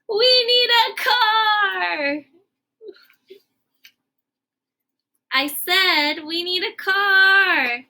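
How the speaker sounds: wailing urgent cry. Acted. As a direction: stretch it out in mock despair. Gerald realises they need a car.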